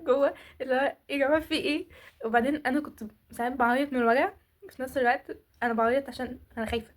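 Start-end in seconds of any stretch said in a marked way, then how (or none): none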